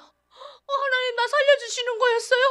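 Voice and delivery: high, crying question to God; tearful realisation. Retold or imitated. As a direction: break down in tears of gratitude. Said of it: "눈물을 쫙 흘리면서".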